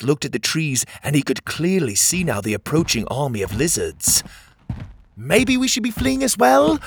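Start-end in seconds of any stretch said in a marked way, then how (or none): 5.1-6.8 high, worried call; raised, high-pitched questioning shout. A narrator's reading. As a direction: call the question out over a distance. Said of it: "Pockets shouted".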